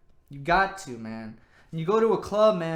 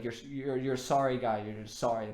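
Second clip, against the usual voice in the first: lower, dismissive imitation of a girl; girls mocking; 5 semitones lower, 3 dB quieter than usual. A girl brushing off a sorry guy, lower and dismissive. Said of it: "girls be saying stuff like that".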